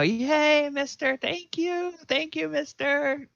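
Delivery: very high, loud kiddie cheer; high-pitched children's thank-you; cheering kids. Acted. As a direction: squeal it like delighted little children. GM voices cheering little kids.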